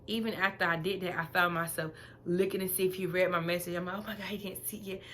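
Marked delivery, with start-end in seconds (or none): 4.0-5.0 fast, soft anxious aside; anxious mutter. Retold or imitated. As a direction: fret to yourself under your breath. Checking if he read message.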